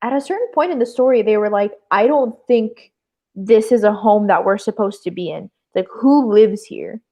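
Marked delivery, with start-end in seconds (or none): none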